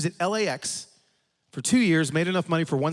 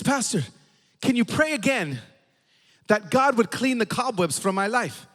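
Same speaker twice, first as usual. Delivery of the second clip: higher, lightly imitated plea; tearful old lady; 6 semitones higher than usual. Old lady back at the front asking again, mildly tearful plea. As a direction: plead slowly like a mock-tearful old lady. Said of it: "She's still crying".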